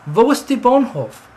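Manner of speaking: high, loud tourist asking directions. Pretend. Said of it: "you went to somebody and you said".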